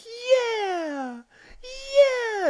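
very high, loud cheer; exultant whoop. Retold or imitated. Peter cheering as he rides the luck dragon, exultant whoop.

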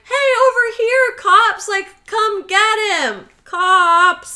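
high, loud mock holler for help; calling out. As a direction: imitate hailing someone from afar, loud and drawn out. Imitated calling out to police.

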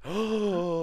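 long, high gasp; shocked gasp. Acted. Friend reacting to a certain list, mock-horrified gasp.

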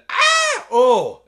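very high, loud shriek; loud scream. Retold or imitated. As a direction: scream from far away. Daughter screaming far away.

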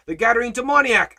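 high, loud outburst of worry; worked-up follower. Acted. Follower alarmed by the Gadarene demoniac, high and loud. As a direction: raise the alarm, agitated. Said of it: "We get all worked up".

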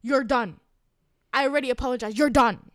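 loud, final, cutting it off; angry repeated shout. Retold or imitated. Stranger at Five Guys shutting her down, loud angry shout. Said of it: "and he goes".

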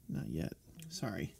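soft confessional whisper; whispered aside. Real, not acted. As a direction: drop to a guilty whisper. Hushed joke aside.

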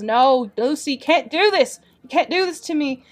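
high, loud cry of grief; acted sobbing plea. A narrator's reading. Gordon cries over his fallen friend Lucy, acted anguished plea. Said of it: "he's sobbing, and he says".

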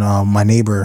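normal talking voice

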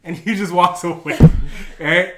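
usual speaking voice

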